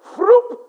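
high, loud startled yelp; startled froop. Retold or imitated. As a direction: jolt upright with a yelp. Kids startled upright.

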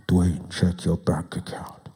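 slow, hushed divine whisper; whispered God voice. Acted. God whispering to Dwayne among his flatmates, hushed and slow. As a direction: breathe it like a voice from nowhere. God's voice heard again.

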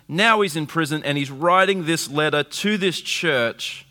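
usual speaking voice

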